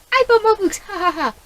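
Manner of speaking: high, loud gleeful confession; gleeful shout. Pretend. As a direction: shout it with cheeky glee. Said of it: "be like".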